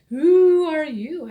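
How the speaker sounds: slow, loud and high like a hooting owl; the owl calling down to strangers in the dark. A narrator's reading. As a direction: draw out the question like a hoot. Owl greets the night visitors.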